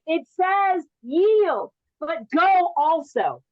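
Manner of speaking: slow, mocking road-sign read. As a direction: spell the sign out like a dawdling driver. Mocking slow drivers reading signs.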